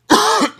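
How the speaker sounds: sharp real cough; a sudden hacking cough. Real, not acted. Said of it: "excuse me".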